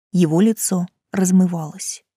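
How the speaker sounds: ordinary delivery, nothing marked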